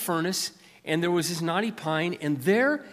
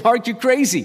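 louder, higher mock scoff from Brent; scoffing friend; 8 semitones higher, 8 dB louder than usual. Friend scoffing at a hopeless rent budget, mock disbelief. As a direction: toss it off like it's a crazy idea. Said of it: "he laughed".

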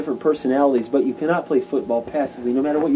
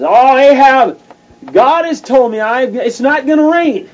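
much higher and louder, slow proclamation; bold prophet proclaiming; 9 semitones higher, 8 dB louder, slower than usual. Acted. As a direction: announce it boldly to the king. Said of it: "Elijah goes to Ahab and he says".

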